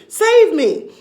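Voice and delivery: high, loud scream for help. A narrator's reading. Wife screaming as balloons carry her off, loud scream. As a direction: yell it out as she shoots skyward. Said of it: "she screamed".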